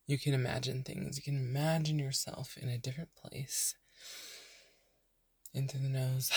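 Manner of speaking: unhurried meditation voice with long pauses; guided meditation. Real, not acted. Podcast host leading burned-out listeners into breathing, calm meditation voice. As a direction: softly guide them into rest, leaving space to breathe.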